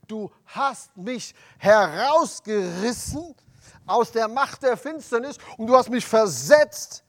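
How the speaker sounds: loud, drawn-out preaching cry; fervent proclamation. Acted. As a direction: proclaim it as if to the whole hall. Preacher proclaims scripture verse aloud.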